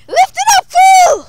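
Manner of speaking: loud high mock command; bossy shout. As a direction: bark it like a tyrant. Bossing friend.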